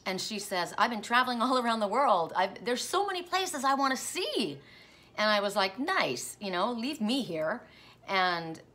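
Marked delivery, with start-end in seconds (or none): 0.8-4.6 mom's voice. Retold or imitated. Mom's answer retold in dream. 5.8-7.6 sarcastic. Retold or imitated. Sarcastic retort to mom.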